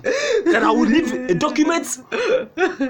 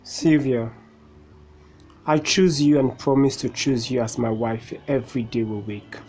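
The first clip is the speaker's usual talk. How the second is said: saying wedding vows; 10 semitones lower, 4 dB quieter than usual. Acted. Groom says his wedding vows.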